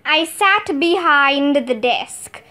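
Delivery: ordinary delivery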